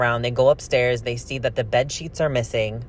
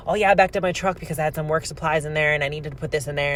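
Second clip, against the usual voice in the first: rushed mock excuse, a bit higher; rambling; 3 semitones higher, faster than usual. Husband rambling an excuse to the police, rushed and higher. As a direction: gabble the excuse fast, imitating him. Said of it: "and and rambling, rambling, rambling".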